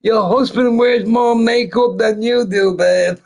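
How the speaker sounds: high, loud teasing jab; loud high-pitched ribbing of the wife; mocking taunt. Taunting Melania, laughing.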